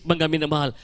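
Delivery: ordinary talk, nothing marked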